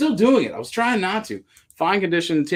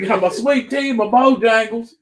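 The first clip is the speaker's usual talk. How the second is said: high, louder Southern twang through a laugh; mock Southern accent; 8 semitones higher, 5 dB louder than usual. Seller riffing on a friend's Southern joke in a mock Southern accent. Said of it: "After that accent".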